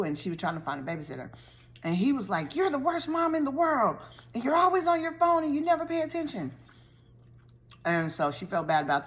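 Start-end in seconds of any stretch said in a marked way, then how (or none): 2.6-6.5 put-on a kid's high, whiny complaint thrown at mom; sulky child telling off his mother; pitched-up bratty accusation; angry kid. Son yelling at his mom.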